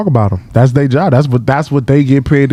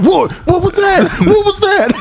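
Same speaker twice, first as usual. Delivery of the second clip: very high, panicky questioning; startled cop. Retold or imitated. Mimicking the cop who heard the shots, startled squeal. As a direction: squeal it like a spooked officer. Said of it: "And he was like".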